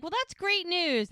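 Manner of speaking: high, upbeat mock-dad cheer; delighted dad. Dad hears the boyfriend is moving in, delighted.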